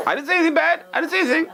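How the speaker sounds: high, laughing mock innocence. Teacher playing the innocent insinuator, laughing high. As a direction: protest innocence through a laugh.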